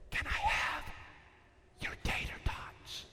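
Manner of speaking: slow unvoiced whisper of a secret ask. Mitchell asking for Colby's tater tots, sly whisper. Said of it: "he said".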